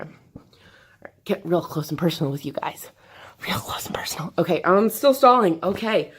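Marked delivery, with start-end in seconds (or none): 0.0-4.3 soft close whisper to the camera. Real, not acted. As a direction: lean in and whisper it intimately.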